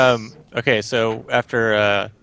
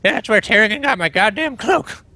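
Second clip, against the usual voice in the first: put-on grumpy old man; 10 semitones higher than usual. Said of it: "dying of my old man voice".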